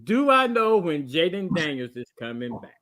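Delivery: measured, emphatic re-explaining with pauses. Real, not acted. Repeating himself to a confused cohost, slow and deliberate. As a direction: spell it out word by word. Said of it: "let me say it a little bit slower".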